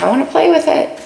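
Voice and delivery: high, eager kitty voice; playful cat. Pretend. Reader voicing the card's cat wanting to play, high and eager. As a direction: squeak it like a cat spotting a toy.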